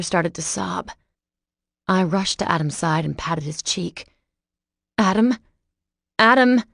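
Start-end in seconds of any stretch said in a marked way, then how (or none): 5.0-6.6 higher, louder, rising worried call. A narrator's reading. Sam calling knocked-out Adam, worried rising call. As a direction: call him back, rattled. Patting a knocked-out friend's cheek.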